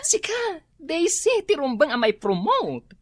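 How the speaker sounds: high, bright exclamation; excited flattery. Acted. Office mate insisting Daisy deserves promotion, high and excited. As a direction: sell the compliment high.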